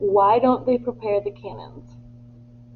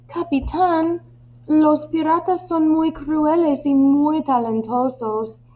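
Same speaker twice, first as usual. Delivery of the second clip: higher-pitched crewman's voice; worried crewman; 7 semitones higher than usual. Acted. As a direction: appeal to the captain in a raised pitch. Crewman pleads with captain about pirates. (marinero preocupado, en tono agudo)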